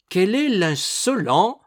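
high, loud, slow demand; indignant cry. A narrator's reading. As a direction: cry out at an insolent intruder. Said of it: "s'écria Lucrèce". (cri indigné)

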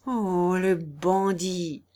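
slow, drawn-out indignant groan; outraged exclamation. A narrator's reading. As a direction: stretch out the insult in outrage. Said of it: "s'écria le bailli". (exclamation indignée et traînante)

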